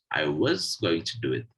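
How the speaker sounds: "was" said in full strong form; stress on was. Shown as a demonstration. Teacher explaining the second example, stressing "was". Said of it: "words that have a full stress".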